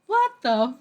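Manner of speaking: loud, high half-cursed exclamation; mock stunned outburst; shocked. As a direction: cut off the swear in shock. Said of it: "I was just like".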